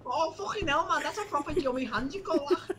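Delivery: very high put-on Scouse; Scouse accent. Stomach monster to the surgeon, high Scouse accent. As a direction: exclaim in a high, comic Scouse voice. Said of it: "The thing, but everyone's Scouts".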